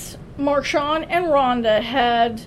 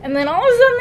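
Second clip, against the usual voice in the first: ordinary delivery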